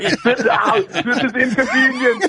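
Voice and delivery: Arnold unbothered, laughing through it; Schwarzenegger impression. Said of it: "He's like".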